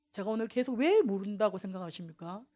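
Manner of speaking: normal talking voice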